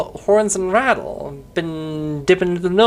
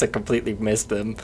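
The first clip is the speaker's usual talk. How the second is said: ordinary delivery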